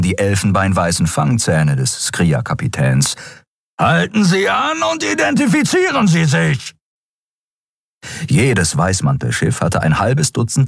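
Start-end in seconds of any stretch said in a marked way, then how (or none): 3.8-6.7 very high, strained shouted order; roaring. A narrator's reading. Captain ordering the fleeing ship to halt, roaring. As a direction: bellow it as a furious captain. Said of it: "brüllte Sron".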